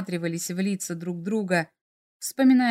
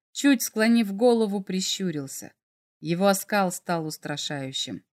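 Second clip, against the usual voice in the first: plain reading voice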